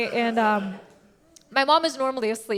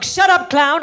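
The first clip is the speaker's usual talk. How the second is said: mildly hammed-up angry snap, louder and higher; furious mom; 5 semitones higher, 5 dB louder than usual. Mom silencing her comedian daughter, mock furious retort. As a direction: cut her off as the cross mother. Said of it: "She's furious".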